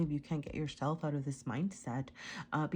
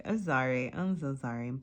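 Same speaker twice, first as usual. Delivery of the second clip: usual speaking voice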